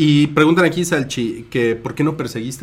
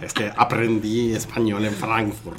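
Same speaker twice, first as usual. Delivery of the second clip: put-on German accent; 4 semitones lower, 7 dB quieter, slower than usual. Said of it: "habla como alemán".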